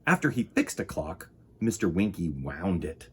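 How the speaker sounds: ordinary delivery, nothing marked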